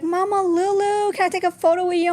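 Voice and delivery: a lightly voiced shy fan asking politely; excited fan. Fan asks for a photo.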